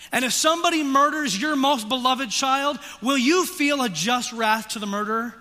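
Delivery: loud, high, driving sermon voice; fiery preaching. Acted. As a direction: press the guilt home from the pulpit. Preaching at full force.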